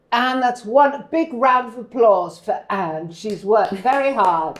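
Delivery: big, loud, slow announcement; applauding tutor. Retold or imitated. Tutor praising Anne before the class, loud slow proclamation. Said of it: "I always remember the tutor going".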